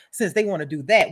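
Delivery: ordinary delivery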